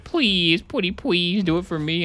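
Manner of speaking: high, wheedling plea; pleading Tina. Retold or imitated. Tina begging Lilith to do the Firehawk thing, high pleading. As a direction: beg sweetly like a kid. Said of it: "And Tina's like".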